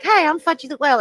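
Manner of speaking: Fudgy the Whale. Retold or imitated. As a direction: lightly imitate a goofy cartoon character. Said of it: "he was doing the silly voice".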